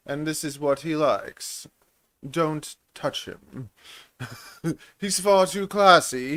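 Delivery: put-on refined presenter voice; pet-advert presenter. Introducing a snake for adoption in a short, affected presenter voice. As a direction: present the pet like a precious treasure, with airs. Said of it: "I wanted to do little shorts".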